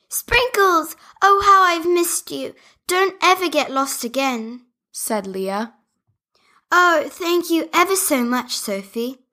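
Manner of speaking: high, delighted doll voice; overjoyed at finding the dog; joyful reunion. Acted. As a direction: Leah greets her lost dog with joy.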